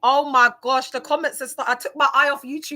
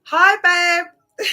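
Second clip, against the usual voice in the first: a bright, loud, higher hello to him; excited greeting; 8 semitones higher, 9 dB louder than usual. Real, not acted. Greeting TikTok husband Jermaine in chat, excited and louder. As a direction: gush a hi to your crush.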